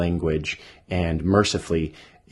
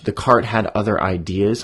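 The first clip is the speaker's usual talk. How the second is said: plain reading voice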